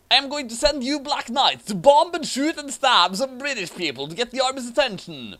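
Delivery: high, loud mock-hero declaration; mock heroic voice. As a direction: ham it up like a cartoon hero. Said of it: "Awesome said intelligently".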